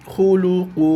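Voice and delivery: খু লু কু as three even, drawn-out u syllables; pronunciation model. Shown as a demonstration. Teacher answering how the letters sound, careful pronunciation model. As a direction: intone খু লু কু evenly, each vowel held. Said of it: "অক্ষরটা উচ্চারণ কিভাবে হবে".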